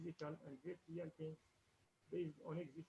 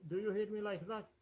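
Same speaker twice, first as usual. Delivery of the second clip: raised voice, straining to be heard; louder into the mic; 4 semitones higher, 10 dB louder than usual. Real, not acted. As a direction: speak up loudly so they hear. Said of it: "Your voice is low".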